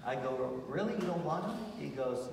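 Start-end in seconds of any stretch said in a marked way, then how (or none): 0.7-1.6 high, surprised questioning. Retold or imitated. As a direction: act out mild disbelief, pitch lifting. Retold reaction to cookies declined.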